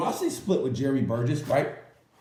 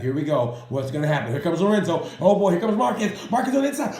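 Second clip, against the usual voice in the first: building up, louder and higher to a shout; mock race commentary; 4 semitones higher, 4 dB louder than usual. Fan imitating a commentator at the race finish, building to an excited shout. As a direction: call the last laps like a commentator getting more and more excited.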